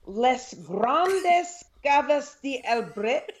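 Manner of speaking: French wine name read as Spanish; Spanish accent. Real, not acted. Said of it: "I'm saying it like it's Spanish".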